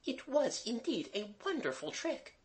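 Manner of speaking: high, soft governess voice; Mademoiselle. A narrator's reading. Governess praising the children's trick, admiring. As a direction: marvel in a very high, softened voice.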